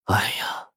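hushed, voiceless long sigh. A narrator's reading. Nephew about to give bad news, sighing. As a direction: sigh it out softly. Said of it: "一声长叹呐".

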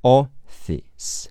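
"Office" split into slow separate sounds; sounded-out pronunciation. Shown as a demonstration. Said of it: "S是字母C结尾的异步发音".

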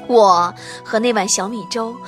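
ordinary talk

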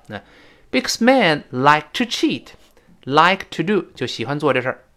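ordinary talk

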